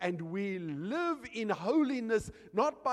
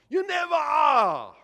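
loud, high preaching cry; emphatic shout; 8 semitones higher, 11 dB louder than usual. Real, not acted. Preacher freeing listeners from being holy as God, emphatic shout. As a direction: drive it home at full voice.

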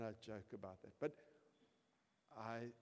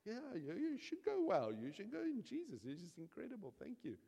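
high, breathless, star-struck praise; gushing congregation; 7 semitones higher, 4 dB louder than usual. Retold or imitated. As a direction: gush like an impressed churchgoer. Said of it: "And you all go".

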